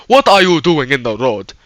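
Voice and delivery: mock African accent, quoting an indignant question. Driver challenging the person he just hit in the road, African accent. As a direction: put on an African man's accent. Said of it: "that's how he sounded".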